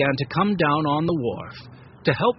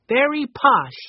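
high, louder mock-posh remark; playfully posh; 10 semitones higher, 5 dB louder, slower than usual. A narrator's reading. Benny joking about the fancy ship, mock posh. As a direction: say it like a grand joke.

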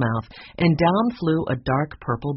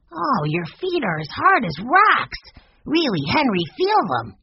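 put-on surprised girl; 9 semitones higher than usual. A narrator's reading. Violet feeling Peter's hard feet, high and amazed. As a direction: marvel at it like a young girl.

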